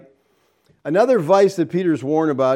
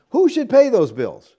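ordinary talk

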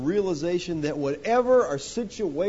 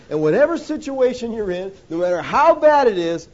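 rising preacher shout; 5 semitones higher, 9 dB louder than usual. Real, not acted. Preacher building to a loud climax.

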